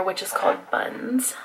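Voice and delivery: whispered product name. Real, not acted. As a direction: hush it like a secret. Naming a product called Buns.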